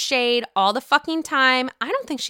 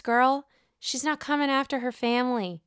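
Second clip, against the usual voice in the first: normal talking voice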